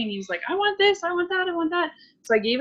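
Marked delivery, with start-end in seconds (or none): 0.5-1.9 put-on high, whiny toddler begging; mock kid whine, much higher; imitating a whining child. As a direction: mimic the little boy whining for treats. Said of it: "whining and crying".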